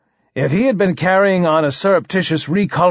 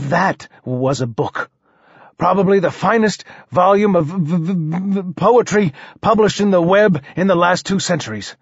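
higher, admiring, halting voice; stuttering king; 4 semitones higher than usual. Acted. King praises the poet's Cantos, stammering. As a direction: praise earnestly, snagging on consonants.

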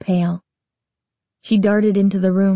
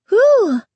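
loud high sigh of relief; relieved whew; 5 dB louder than usual. A narrator's reading. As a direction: blow out the relief. Relief after removing mask.

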